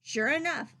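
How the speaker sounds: plain reading voice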